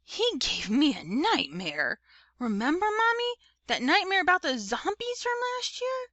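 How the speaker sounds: put-on whiny little kid telling Mommy about a nightmare; small child with rising, pleading pitch; little brother. A narrator's reading. Little brother voiced in story.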